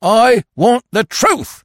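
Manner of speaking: loud, high, slow roar; thundering. A narrator's reading. Detective demands the truth from prisoner, thundering. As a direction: thunder it out, each word drawn out. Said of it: "thundered Falmouth".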